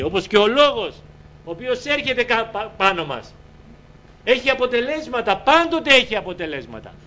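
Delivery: high, insistent sermon delivery; impassioned preaching. Real, not acted. Preacher explains the water cycle to congregation, fervent. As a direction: drive the point home, pitched high.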